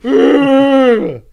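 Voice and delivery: whiny shouted cry in a hammed-up British voice; Ron impression. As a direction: wail her name in mock despair. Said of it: "You just go".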